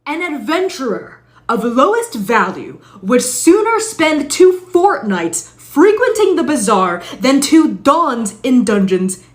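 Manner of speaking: grandiose archaic rant building to loud; pompous old-timey adventurer. Acted. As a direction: lecture like a self-important knight of old.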